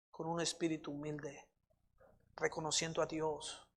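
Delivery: hushed, unhurried address to God; prayer. Real, not acted. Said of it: "Vamos a orar".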